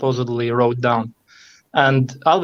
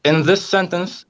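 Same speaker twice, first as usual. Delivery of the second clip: normal talking voice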